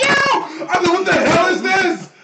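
high, loud half-shout cracking into laughter; laughing exasperated yell. Retold or imitated. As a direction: protest through laughter, overwhelmed. Exasperated at pressure in game.

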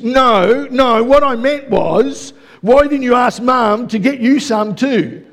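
put-on a kid guarding his chips, insisting; indignant son. Son tells his dad he should have asked Mum.

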